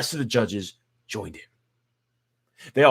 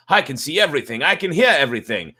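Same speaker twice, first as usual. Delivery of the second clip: put-on raised, louder old man calling out; old man crying out from the bag; 5 semitones higher, 7 dB louder than usual. A narrator's reading. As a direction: call out from inside the sack, higher and louder. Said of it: "The old man cried from the bag".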